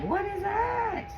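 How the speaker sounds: high, slow mock alarm; hamming up the dishwasher scare; retold what is that. Recalling the dishwasher noise, mock alarmed. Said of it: "I'm like".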